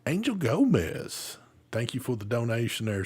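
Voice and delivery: ordinary delivery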